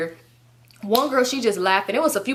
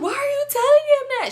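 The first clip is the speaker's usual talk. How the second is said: very high imitated screech of protest; mock mad outburst. Friend scolds her for telling him, mock mad outburst. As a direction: mimic an angry friend, pitched way up. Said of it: "She's really mad".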